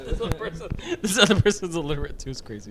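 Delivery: laughing through the words. Real, not acted. Laughing as she repeats her cohost's joke about the poster. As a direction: giggle the quote back.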